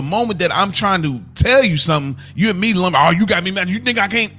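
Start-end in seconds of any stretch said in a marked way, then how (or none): none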